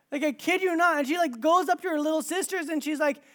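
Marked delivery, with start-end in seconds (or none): none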